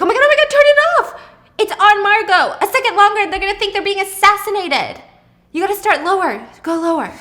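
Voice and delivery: high, loud, rushed panic; panicked. Retold or imitated. Pippa panicking at her husband as the laser hits the neighbor, frantic and loud. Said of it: "she's like".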